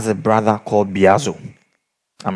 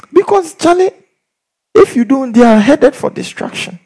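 pitched way up, pleading; urgent high plea; 14 semitones higher, 3 dB louder than usual. Real, not acted. Preacher pleading with the congregation, high and urgent. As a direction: push it high, urging them on. Preacher urging at full force.

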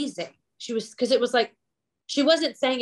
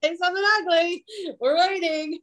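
higher, teasing dare; mock challenge; 10 semitones higher than usual. Teasing friends who start with I don't mean this ugly, a higher mock dare. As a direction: goad them to say the ugly thing. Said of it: "I'm like".

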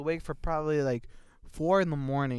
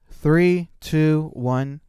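shouted countdown; loud countdown; 14 dB louder, much slower than usual. Real, not acted. Counting down to start.

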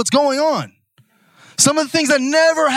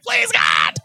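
high, strained mock plea; exasperated plea; 12 semitones higher than usual. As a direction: beg heaven, worn out. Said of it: "I'm just like".

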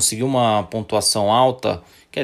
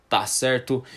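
ordinary delivery

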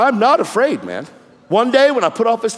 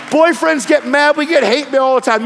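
ordinary talk

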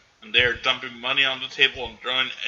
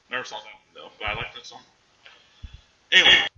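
ordinary delivery

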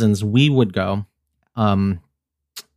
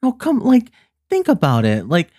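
high, insistent coaxing; grandma pressing; 9 semitones higher than usual. Retold or imitated. Grandma pressing the vendor over the price, insistent. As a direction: push her with mock outrage. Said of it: "She's like".

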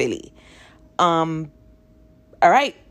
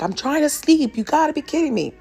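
retold yell; 8 semitones higher than usual. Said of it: "yells again".

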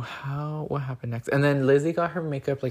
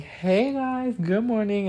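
much higher, cheery hey guys good morning; mock loud greeting; 9 semitones higher, slower than usual. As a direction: imitate a bubbly friend bursting in with a greeting. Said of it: "she walks in so loud".

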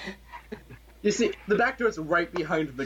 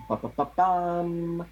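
drumroll; 6 semitones higher, much slower than usual. Acted. GM builds a drumroll.

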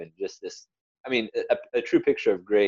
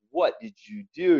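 mock angry dad; 10 semitones higher than usual. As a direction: snap it out like a cross dad catching his son. Anticipating dad's angry reaction.

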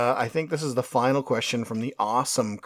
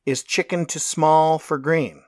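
corrected reading, louder; 5 semitones higher, 6 dB louder than usual. Shown as a demonstration. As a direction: reread it louder and clearer. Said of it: "Sorry, I said that wrong".

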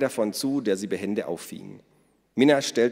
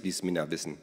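ordinary delivery, nothing marked

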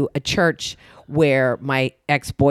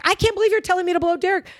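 put-on much higher and fast, scandalized teen girl; outraged friend; much faster than usual. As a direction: rattle it off like a shocked teenage friend. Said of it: "She's like".

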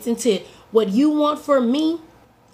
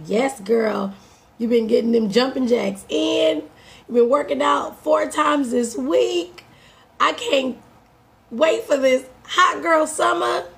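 hyping herself; 4 semitones higher than usual. Retold or imitated. Hyping herself up with positive talk.

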